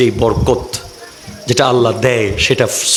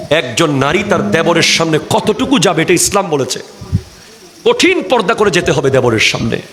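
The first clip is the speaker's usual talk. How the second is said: ordinary talk, nothing marked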